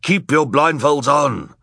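loud, high, drawn-out command; villain's order. A narrator's reading. Villainous man commanding the blindfolded crowd, loud drawn-out order. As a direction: order it slowly and loudly.

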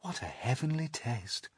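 high, soft, dainty appreciation; prim. A narrator's reading. Ladybird savouring the peach, prim. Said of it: "said the ladybird primly".

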